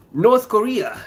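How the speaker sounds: high, loud North Korea; alarmed exclamation. Real, not acted. Alarmed reaction.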